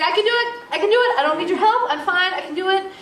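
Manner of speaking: high, loud, defiant insistence on managing alone; retelling her refusal of help, defiant and loud; stubborn insistence. Retold or imitated. Pushes away help, loud and high. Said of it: "It's like".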